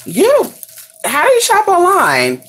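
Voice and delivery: high, squeaky mocking voice of doubters. Mocking people who doubt online shopping, in a high squeaky voice. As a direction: play the baffled skeptic way up high. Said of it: "people be like".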